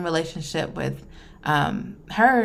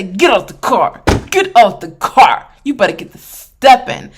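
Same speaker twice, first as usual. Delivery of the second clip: louder mock order to get out of the car; pretend yell at dad; 4 semitones higher, 11 dB louder than usual. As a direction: bark him out of the car, hammed-up. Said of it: "I should say".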